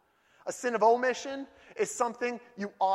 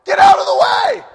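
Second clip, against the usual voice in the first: very high, loud, fast yell; full mock scream at the windshield; road rage scream; 19 dB louder, faster than usual. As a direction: scream at the car ahead. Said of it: "And I'm like".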